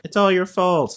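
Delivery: high sing-song blame; mock accusing townsfolk. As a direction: jeer it at her in a mock crowd voice. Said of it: "people are across the street just being like".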